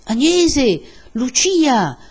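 loud, high name-calling. A narrator's reading. Villagers search the house for the women, calling out. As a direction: call into an empty house. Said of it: "Si chiama".

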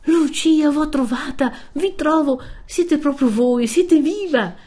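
high, loud cry of overjoyed disbelief; overwhelmed, pitched far up and urgent; trembling exclamation. A narrator's reading. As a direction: exclaim on finding them alive. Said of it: "esclamò Renzo avanzandosi tutto tremante".

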